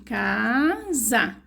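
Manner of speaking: "Casa" split into its syllables, mouth opening on each. Shown as a demonstration. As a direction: count the syllables in "Casa" aloud. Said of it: "Quantas vezes eu abro a minha boquinha".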